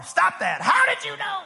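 mama scolding. Retold or imitated.

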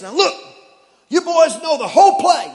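barking gym coach; fired-up coach rallying his boys; football coach voice. Acted. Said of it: "I shifted to the football coach voice".

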